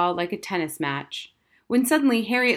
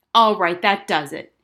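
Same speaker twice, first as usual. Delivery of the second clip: furious; 8 dB louder than usual. A narrator's reading. Furious mother stands up.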